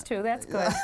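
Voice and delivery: ordinary talk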